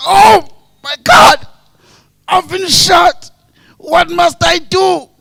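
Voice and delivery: high, slow theatrical shout; exaggerated wounded man's yell; mock shot victim. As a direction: cry out loudly with each word drawn out. Replaying the shot man's cry.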